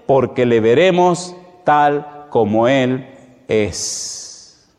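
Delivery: slow, emphatic proclamation of a verse; preached scripture reading. Acted. Preacher reads John's promise to the congregation, slow declamation. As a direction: declaim it solemnly, stretching each phrase.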